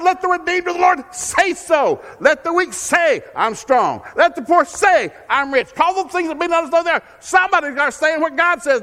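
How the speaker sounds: loud, high, driving preacher's call; full-force preaching. Acted. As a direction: thunder it out to the congregation.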